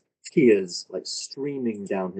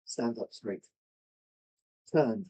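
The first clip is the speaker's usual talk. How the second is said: normal talking voice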